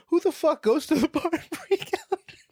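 high, loud incredulous question; incredulous outburst. Real, not acted. Comedian mocking drinking at Marie Callender's, incredulous outburst. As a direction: burst out in disbelief, high-pitched. Comic outburst at bar idea.

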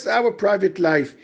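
normal talking voice

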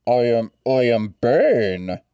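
lightly voiced Bane, nerdy; a weak, nerdy take on Bane. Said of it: "you sound like a nerd".

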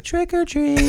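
put-on very high, sweet child voice; sweet little girl. Dad imitating his daughter at the doors, all sweet. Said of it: "Being all sweet, like".

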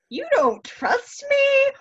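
very high, slow, squeaky indignant voice; offended disbelief. Retold or imitated. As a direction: squeal it out like a hurt friend. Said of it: "And Faust is like".

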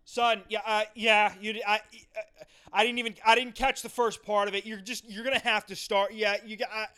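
high, halting irritated father impression; stern dad, never finishing. Dad asking son to start over, stern and halting. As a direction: trail each sentence into the next, holding anger back. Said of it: "he doesn't complete a sentence".

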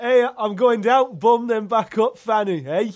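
loud, high and slow, gleeful "Hey, I'm going down"; gleeful tease. Retold or imitated. Teasing his northern mates, loud and gleeful. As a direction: crow it out, pleased with the joke. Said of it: "I'm like".

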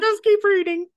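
very high squeal with laughter; laughing squeal. Real, not acted. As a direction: shriek it through a laugh. Repeats even higher, laughing.

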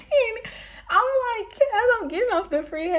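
high, squeaky laughing retelling; laughing through words. Real, not acted. Woman recalls arriving at the casino, cracking up. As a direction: struggle to get it out through real laughter.

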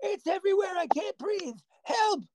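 high, panicked cries for help; hammed-up choking panic; mock panic. Mock choking after cough.

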